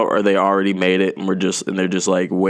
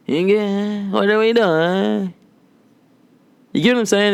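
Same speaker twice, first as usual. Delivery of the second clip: exasperated high voice; 11 semitones higher than usual. Pretend.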